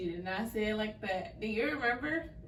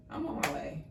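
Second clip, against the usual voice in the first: lower, curt I'm on my way; mock attitude; 5 semitones lower, 4 dB quieter than usual. Cousin imitating daughter's phone reply, curt mock attitude. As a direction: brush them off, clipped. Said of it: "And you were like".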